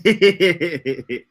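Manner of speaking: long, drawn-out New York "whatevah"; laughing New York imitation; imitated New York accent. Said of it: "Shaniqua be talking".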